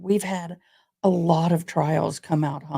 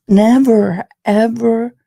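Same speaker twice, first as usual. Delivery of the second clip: emphatic; 2 semitones higher, 10 dB louder, slower than usual. Real, not acted. Emphatic repeat after song.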